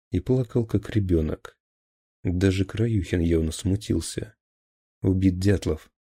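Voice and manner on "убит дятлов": usual speaking voice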